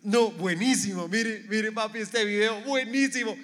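high, loud gushing enthusiasm; acted excitement. Child showing papi a funny video, excited.

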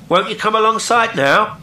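high, loud, level invitation; even-voiced call. A narrator's reading. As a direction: call steadily over the rail. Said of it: "asked Will in an even voice".

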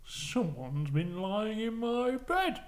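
very slow, put-on father bear, rising in pitch; Daddy Bear. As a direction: stretch it out as a storybook daddy bear. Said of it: "Daddy Bear goes".

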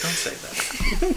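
slow, breathy laughing whisper; Angela. Retold or imitated. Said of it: "Angela's response is like".

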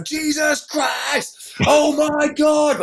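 high, drawn-out wail of panic; imitated terrified screaming. Passenger next to him as the plane dives, long high screams. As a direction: stretch each word into a slow, high scream. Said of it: "like this".

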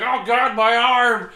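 high, loud hammed-up wail of pain; mock pained cry. Storyteller mimicking a man hit by a bus, mock pained cry.

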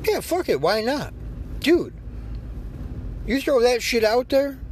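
high, excited push to just release it; hyped up. Real, not acted. Host urging producers to release their beat, excited and high. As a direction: get them fired up, pitch way up.

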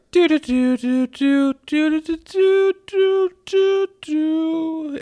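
very high "doo doo" tooting; squeaky mimicked fanfare from afar; imitated distant noise. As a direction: toot a string of high-pitched doos. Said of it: "you just hear from the distance".

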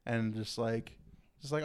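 ordinary talk, nothing marked

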